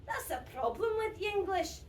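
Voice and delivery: ordinary delivery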